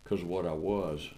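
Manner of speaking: ordinary talk, nothing marked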